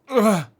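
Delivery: loud strained grunt while crouching; effortful groan. Real, not acted. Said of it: "forgive me if I make a lot of old man noises".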